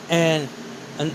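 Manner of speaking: plain reading voice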